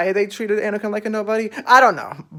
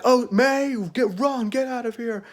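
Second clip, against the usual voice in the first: mock urgent plea; 6 semitones higher than usual. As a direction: urge her to flee, acted lightly. Acting out Coral urging May to flee.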